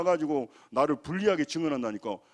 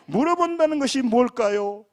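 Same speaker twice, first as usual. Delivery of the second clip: louder preaching question; 7 semitones higher, 7 dB louder than usual. Acted. Pastor pressing the congregation with the question, louder and higher. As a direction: ask it with force and a higher voice.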